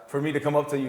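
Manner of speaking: ordinary talk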